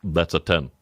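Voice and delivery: low-pitched taster's pronouncement; mock verdict. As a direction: imitate a taster, low and falling. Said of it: "he goes".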